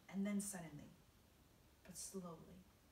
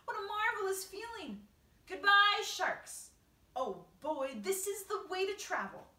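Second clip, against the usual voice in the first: high, loud elation; crying out; 11 semitones higher, 12 dB louder than usual. A narrator's reading. Creatures celebrating their flight, crying out. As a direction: whoop it out in joy. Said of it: "they cried".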